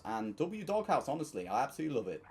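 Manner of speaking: usual speaking voice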